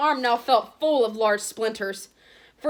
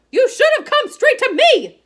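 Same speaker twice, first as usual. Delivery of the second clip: furious high shout, much louder; raging; 12 dB louder than usual. A narrator's reading. Matron scolding Harry for coming late, furious shout. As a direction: scold him at full volume. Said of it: "she raged".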